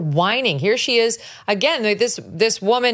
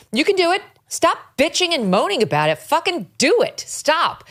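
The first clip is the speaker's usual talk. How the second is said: pitched up and pushing, exasperated; exasperated outburst; 10 semitones higher than usual. Real, not acted. Lawyer telling Michelle to quit complaining, exasperated. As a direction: scold her to just get on with it.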